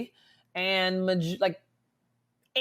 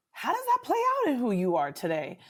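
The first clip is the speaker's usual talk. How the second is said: ordinary talk, nothing marked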